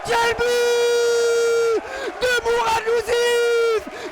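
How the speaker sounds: roaring goal call, long held vowels. Real, not acted. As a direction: bellow the goal to the whole stadium. (cri de but enflammé)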